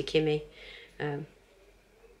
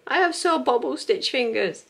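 mock complaint; 8 semitones higher, 4 dB louder than usual. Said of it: "I was like".

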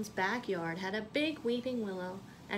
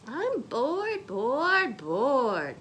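high whiny complaint, louder, falling away; bored whine; 4 semitones higher, 6 dB louder, much slower than usual. Acted. Gertie whining to her mom, bored. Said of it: "she heard Gertie say to her mom".

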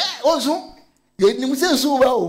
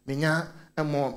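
normal talking voice

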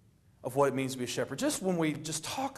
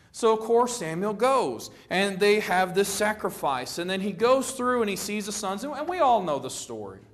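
usual speaking voice

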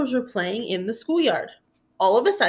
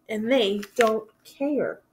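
sad and slow; slower than usual. Acted. Minosaur sad alone.

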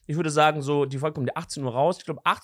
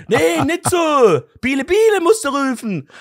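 viel höher, lauter, sehr langsam, Frauenstimme; empört belehrend wie die Frau im Clip; Frau ruft Enten; 9 semitones higher, 8 dB louder, slower than usual. Retold or imitated. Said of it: "Und dann sagt sie". (Frau korrigiert ihn laut und hoch)

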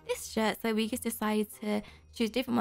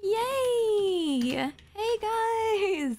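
high, drawn-out squeal of delight; thrilled, stretched-out high greeting; excited; 7 semitones higher, much slower than usual. Real, not acted. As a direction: squeal happily as you greet friends. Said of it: "I'm so excited".

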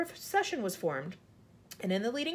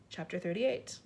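usual speaking voice

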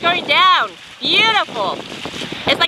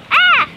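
excited gasp; 6 dB louder than usual. Real, not acted. Exclaims at sunset.

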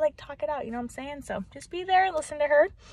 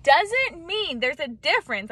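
ordinary talk, nothing marked

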